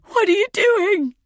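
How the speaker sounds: very high, loud cry of alarm; high panicked shout. A narrator's reading. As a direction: cry out in terror as if grabbed. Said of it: "I cry out".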